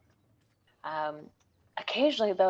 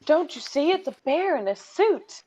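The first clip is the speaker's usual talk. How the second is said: high excited mock yell, louder; retold yelling; 9 semitones higher, 6 dB louder than usual. Ballroom guests yelling at the bear gentleman, high mock shout. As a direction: shout that he's a bear at the crowd. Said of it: "they were yelling".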